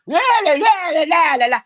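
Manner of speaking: loud, high mock-crying la-la-la; mocking crybaby wail. Mocking an ex who keeps crying, loud taunting wail. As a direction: jeer with a piercingly high imitation of wailing.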